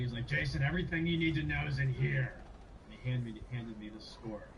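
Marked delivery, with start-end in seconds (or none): 0.3-2.3 imitating a raspy chain-smoking professor, a bit louder; chain smoker voice. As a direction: rasp it out like an old smoker handing over a gift. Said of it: "so he kinda talks like this".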